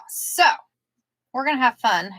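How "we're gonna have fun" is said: ordinary talk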